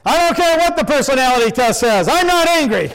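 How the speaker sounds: high, loud, insistent denial; mock furious woman yelling she's not angry; indignant protest. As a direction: plant your fist and deny it shrilly. Woman pounding fist at counselor.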